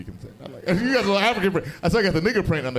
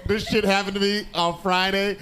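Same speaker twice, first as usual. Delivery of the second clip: usual speaking voice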